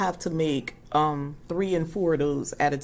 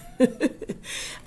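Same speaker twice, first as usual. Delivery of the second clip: airy laugh; 9 semitones higher than usual. Real, not acted. Laughing at her own story.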